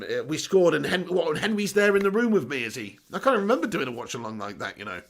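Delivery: ordinary talk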